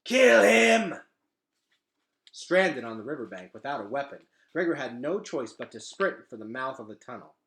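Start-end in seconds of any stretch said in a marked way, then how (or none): 0.0-1.0 loud, much higher snarled command; rat leader's order. A narrator's reading. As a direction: bark out the kill order. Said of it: "pointed at Gregor and hissed".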